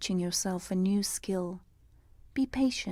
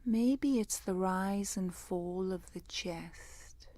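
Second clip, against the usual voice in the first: guided meditation; 6 dB quieter than usual. Real, not acted. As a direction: guide them gently into the breath, unhurried and hushed.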